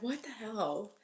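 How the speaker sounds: barely voiced exasperation; muttered disbelief. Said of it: "You're like".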